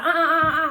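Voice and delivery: loud, high string of objecting noises; urgent "uh-uh-uh". Retold or imitated. Daughter stops mom calling 911, urgent loud protest. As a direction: cut mom off with repeated uhs.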